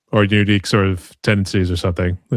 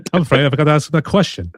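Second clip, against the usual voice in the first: higher, put-on British politeness; badly faked English accent; 7 semitones higher, faster than usual. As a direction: do a hammy English voice. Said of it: "pretty bad English accent".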